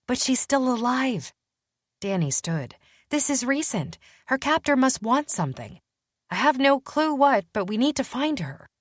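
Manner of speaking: detective urging Matthew to act; insistent Danny. Acted. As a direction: push hard that there's still time. Danny insisting Lucia is alive.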